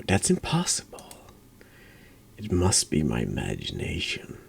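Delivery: ordinary talk, nothing marked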